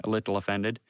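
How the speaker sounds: ordinary delivery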